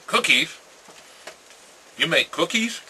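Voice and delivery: loud, high incredulous accusing question. Retold or imitated. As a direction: demand it like he broke the man code.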